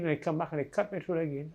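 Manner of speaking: ordinary talk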